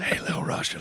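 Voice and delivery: unvoiced, hushed whisper; mock whisper. Host playing Biden whispering to Russia. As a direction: whisper it like a sly secret. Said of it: "let me whisper".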